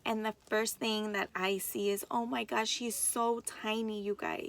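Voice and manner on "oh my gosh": usual speaking voice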